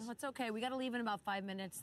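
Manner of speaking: ordinary talk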